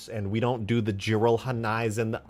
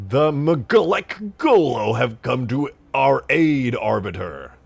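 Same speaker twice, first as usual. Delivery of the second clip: Halo alien voice; 3 semitones higher, 9 dB louder, slower than usual. Acted. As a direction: boom it out like an Elite addressing the Arbiter. Quoting an imagined Halo game line.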